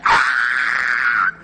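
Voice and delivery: sudden startled shout of alarm; loud cry. Retold or imitated. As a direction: cry out in shock at the mirror. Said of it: "I made a loud cry".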